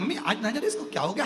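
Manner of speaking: put-on family member alarmed about the baby; worried family member. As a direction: rush to tell grandma. Calling grandmother about the baby.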